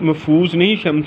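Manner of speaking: usual speaking voice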